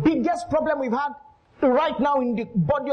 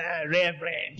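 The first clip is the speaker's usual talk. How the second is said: low, soft scornful mutter of Reverend; a hint of a lady sneering at a preacher; 7 semitones lower, 5 dB quieter than usual. Lady scorns the preacher at the house, low soft sneer. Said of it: "She looked at him and said".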